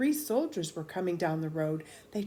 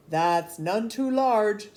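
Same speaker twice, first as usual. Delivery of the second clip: loud slow verdict; 3 semitones higher, 10 dB louder, slower than usual. A narrator's reading. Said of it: "said the soldiers".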